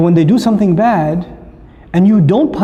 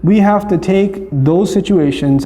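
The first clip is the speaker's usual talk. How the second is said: ordinary delivery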